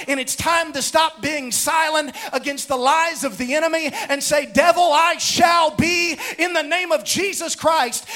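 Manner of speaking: high-pitched preacher building to a shouted climax; impassioned preaching. Real, not acted. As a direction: drive the declaration home at full force.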